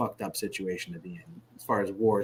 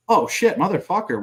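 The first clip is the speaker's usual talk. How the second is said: higher, louder exclamation of alarm; retold alarm; 8 semitones higher, 5 dB louder than usual. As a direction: re-enact realizing it is an ambush. Retells reaction to sudden ambush.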